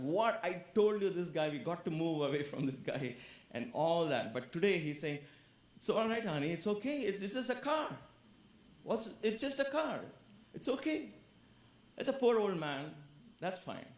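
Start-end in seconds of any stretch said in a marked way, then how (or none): 0.0-3.1 mock outburst. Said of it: "would have jumped out of his skin". 5.8-13.9 very high, light reassurance; reassuring husband. Acted. Husband calmly reassuring his wife.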